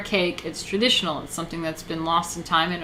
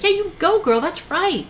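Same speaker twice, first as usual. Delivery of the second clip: much higher gushing cheerleader; mock cheering fan; 11 semitones higher than usual. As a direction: squeal approval like a sycophant. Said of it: "They're like".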